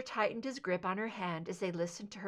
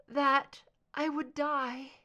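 fading to a sigh; 6 semitones higher, 4 dB louder, slower than usual. Acted. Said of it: "Her voice faded away to a sigh".

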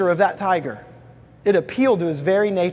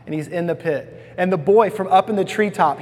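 ordinary talk

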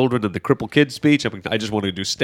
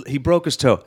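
ordinary delivery

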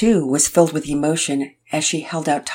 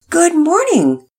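group greeting; 14 semitones higher, 7 dB louder, slower than usual. Acted. Family answering in unison.

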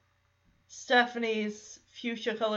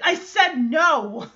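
irritated; 5 semitones higher, 6 dB louder, slower than usual. Retold or imitated. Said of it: "Kimmy's getting irritated".

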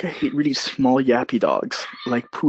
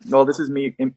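usual speaking voice

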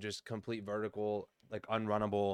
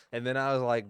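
ordinary talk, nothing marked

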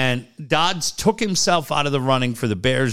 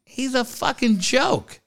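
scornful jab; 11 semitones higher, slower than usual. Retold or imitated. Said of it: "I'm like".

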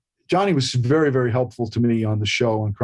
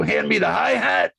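much higher, shouted over-the-top impression; Polito's manic yell; 7 semitones higher than usual. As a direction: bellow it like the unhinged character. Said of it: "The voice of his?".

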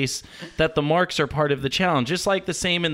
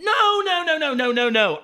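frantic refusal; 11 semitones higher than usual. Acted. Caller refuses wait.

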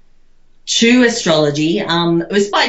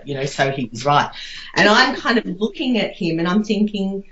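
ordinary talk

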